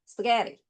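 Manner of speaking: "스파게티"의 앞부분만 들리게, 뒤는 흘려서; "스파게티"를 원어민처럼 줄여 발음; 영어식 발음. Shown as a demonstration. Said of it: "얘네들은 스파게티 이렇게 얘기하는 거다". ("스파게티"를 영어식 강세로)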